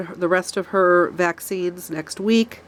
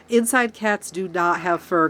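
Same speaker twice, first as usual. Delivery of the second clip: usual speaking voice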